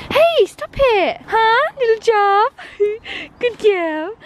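high, slow coo to the puppy; fond scolding in a sing-song. Real, not acted. Cooing at her licking dog in high baby talk.

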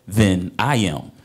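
usual speaking voice

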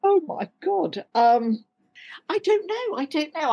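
high, flustered exclamation. Real, not acted. Guest stumped by a recommendation question, high flustered. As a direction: caught off guard, voice jumping up.

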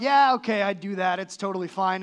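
lightly voiced careless believer brushing off the verse; mock breezy shrug. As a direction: toss it off like it's nothing. Mock casual reader's reaction.

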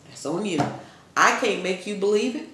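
ordinary talk

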